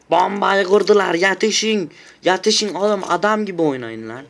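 panicked call-out after the bomb is planted; alarmed yelling. Real, not acted. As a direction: sound the alarm to the team.

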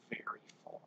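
fading whisper; softly whispered; sleepy whisper. Acted. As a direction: drift off to sleep. Sleepy hare trailing off.

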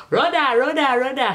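high loud call of Rhoda; calling out to sister. Retold or imitated. Calling her sister Rhoda across the house, loud and high. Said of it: "you might just hear me say".

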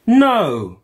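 high, loud shouted no; a loud exasperated no. Acted. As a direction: shout it down at the kids. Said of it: "shouted Joe".

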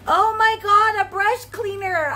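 high, loud delighted "Oh my God"; excited exclaim. Real, not acted. Excited over the brush cleaner.

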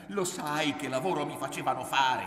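angry, high-pitched challenge; shouted challenge. A narrator's reading. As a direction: shout the question at her. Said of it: "gridò lui".

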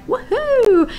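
high whoop of joy; cheering woohoo. Real, not acted. Cheering that comments work.

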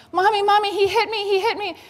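put-on little child running to mommy, crying foul; tattling kid. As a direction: wail like a kid who got hit. Child tattling after being hit.

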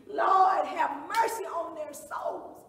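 very loud, drawn-out plea to God; screamed prayer. Acted. Beaten woman praying for her attackers, screamed plea. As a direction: cry out slowly at full volume. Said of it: "And she screamed".

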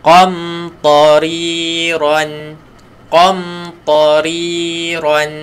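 qa from the back of the tongue; teacher modelling a heavy qa. Shown as a demonstration.

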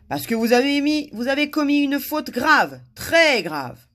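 loud, high indignant charge; stern accusation. A narrator's reading. Guard charges a visitor with a grave offence, stern. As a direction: hammer out the accusation.

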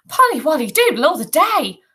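high, loud, swooping singsong; scatty mum's lilting chirp; dotty mother. A narrator's reading. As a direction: trill the nonsense up and down. Dotty mother's nonsense line in story.

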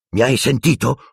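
ordinary delivery, nothing marked

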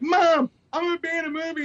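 high-pitched excited holler to mom; re-enacted boyish excitement; excited kid announcement. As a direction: call out big news like a thrilled teenager. Retells teen's news to sleeping mom.

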